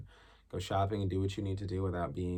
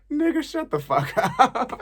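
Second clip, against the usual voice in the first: much higher, loud, slow with a laugh; mom's laughing dismissal; 10 dB louder, slower than usual. Retold or imitated. Mom laughing off the coming out, much higher and louder. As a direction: brush it off laughing. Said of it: "she was like".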